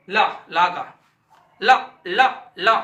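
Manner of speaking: ला said loud and slow, tongue flicking up to the teeth; demonstrating la sound. Shown as a demonstration. Teacher sounding out ला for the class. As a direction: sound out ला clearly for students. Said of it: "उच्चारण करके भी देखते हैं".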